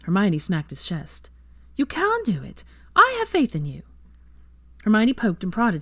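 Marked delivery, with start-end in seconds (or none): 1.8-3.8 higher, bright encouraging woman; Hermione's voice. A narrator's reading. Narrator voicing Hermione encouraging Draco. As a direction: voice Hermione cheering him on.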